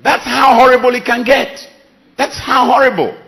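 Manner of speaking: high, loud outcry of dismay; loud exclamation. Real, not acted. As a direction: cry it out, shocked at the horror. Preacher exclaiming.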